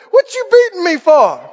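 high, loud comic complaint; hammed-up hurt outrage; indignant donkey. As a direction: protest like a wronged donkey. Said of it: "the donkey turned around and said".

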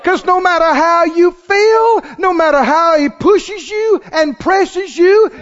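loud, high-pitched sermon climax; full-force preaching. Real, not acted. Preacher tells believers they won't give in, full-force preaching. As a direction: drive it home to the congregation, loud and high.

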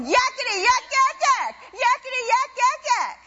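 high, mocking yakety-yak; high jabbering demon voice; demon's chatter. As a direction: nag nonstop like a demon. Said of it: "That demon's going".

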